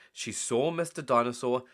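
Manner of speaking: ordinary delivery, nothing marked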